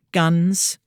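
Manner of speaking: usual speaking voice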